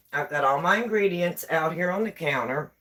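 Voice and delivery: ordinary talk, nothing marked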